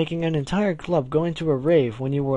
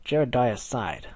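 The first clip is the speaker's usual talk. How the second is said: ordinary delivery, nothing marked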